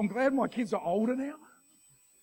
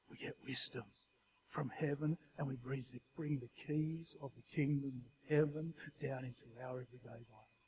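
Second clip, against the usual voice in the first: whispered, much softer; hushed whisper; 6 semitones lower, 12 dB quieter than usual. Real, not acted. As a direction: whisper it like a secret blessing. Sermon climax whispered.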